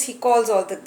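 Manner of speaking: ordinary delivery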